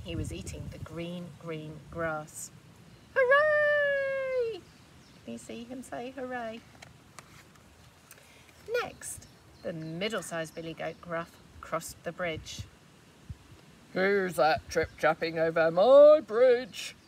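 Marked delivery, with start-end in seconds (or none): 3.1-4.6 cheering. Acted. Little goat cheers. 13.9-17.0 much louder, slow troll roar. Acted. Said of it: "roared the troll".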